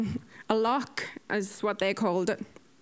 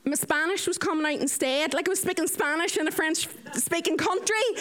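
ordinary talk

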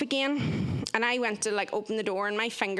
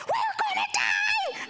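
very high, slow panicked cry; friend's high, slow terrified wail on the bus; 3 dB louder, slower than usual. Retold or imitated. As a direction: wail it in mock terror. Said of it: "she was just like".

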